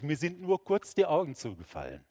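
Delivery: ordinary talk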